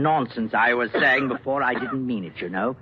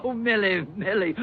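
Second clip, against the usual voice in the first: laughing, pitched much higher; 8 semitones higher than usual. Acted. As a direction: chuckle her name off. Laughing off her refusal.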